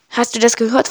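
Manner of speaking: ordinary delivery, nothing marked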